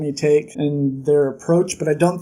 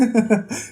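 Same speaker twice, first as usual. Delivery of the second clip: short higher-pitched chuckle; acted laugh; 7 semitones higher than usual. Teacher laughing at the student calling himself a musician, acted chuckle. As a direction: imitate her breaking into a little laugh. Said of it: "she just kinda starts laughing".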